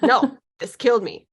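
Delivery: stubborn warrior protesting; a hint of a defiant old warrior; stubborn spirit voice. Voicing stubborn past life.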